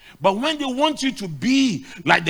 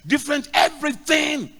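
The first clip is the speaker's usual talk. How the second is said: excited shout; 8 semitones higher than usual. Real, not acted. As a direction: shout it out as the top of the build. Building list climaxes.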